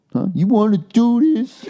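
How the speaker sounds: high, slow mock challenge with a laugh; tough guy. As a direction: square up, half laughing. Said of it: "acted like I was gonna swing".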